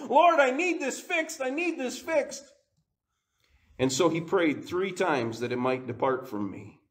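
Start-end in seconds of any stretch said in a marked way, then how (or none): 0.1-2.4 pleading prayer. Retold or imitated. Voicing Paul begging God.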